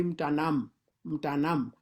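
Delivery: mntanam with a clear hummed M before the T; M sounded in mntanam. Shown as a demonstration. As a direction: start mntanam on a held M. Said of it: "the M is very important".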